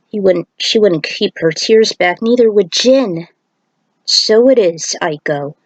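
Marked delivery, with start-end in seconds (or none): none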